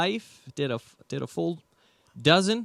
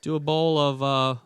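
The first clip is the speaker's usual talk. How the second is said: loud Thai-menu showoff; 7 semitones higher, 5 dB louder than usual. Retold or imitated. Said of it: "I was like".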